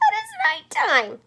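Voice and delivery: high slow squeak; squeaky voice. Acted. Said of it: "Stellaluna squeaked".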